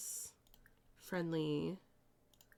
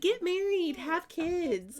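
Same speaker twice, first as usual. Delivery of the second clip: nagging advisor; 11 semitones higher, slower than usual. Retold or imitated. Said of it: "she's like".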